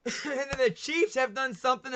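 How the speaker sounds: laughing. Real, not acted. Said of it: "Sorry, I'm choking up".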